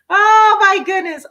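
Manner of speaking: high, loud burst of surprise; delighted surprise. Real, not acted. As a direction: gasp it out, thrilled. Delighted reaction to a viewer.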